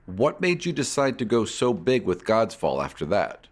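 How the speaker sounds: normal talking voice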